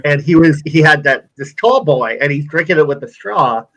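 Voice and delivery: ordinary talk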